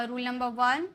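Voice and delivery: ordinary delivery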